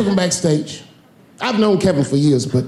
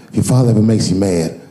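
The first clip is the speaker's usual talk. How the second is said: Kevin voice; 8 semitones lower than usual. Retold or imitated. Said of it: "He goes".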